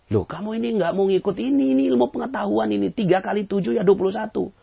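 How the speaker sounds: a teacher scolding a man who won't accept the sum; exasperated teacher. Retold or imitated. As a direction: lecture him in disbelief.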